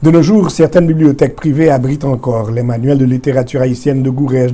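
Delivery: normal talking voice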